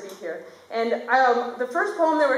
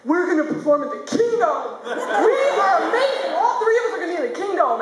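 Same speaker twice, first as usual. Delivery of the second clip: higher, excited dad's announcement, laughing at the end; 7 semitones higher, 4 dB louder than usual. Retold or imitated. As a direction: announce it like a thrilled father. Dad voiced, excited announcement.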